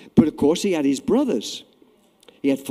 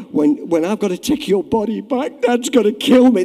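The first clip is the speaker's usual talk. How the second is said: panicked brother; 5 semitones higher, 6 dB louder than usual. Retold or imitated. Brother panicking, half laughing.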